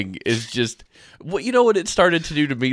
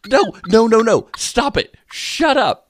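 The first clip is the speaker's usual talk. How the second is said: high, rapid-fire refusals; high-pitched exasperated retelling; frantic telling-off; 8 semitones higher, 3 dB louder, slower than usual. Retold or imitated. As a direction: cut the machine off in mock alarm. Acted panic at wrong song.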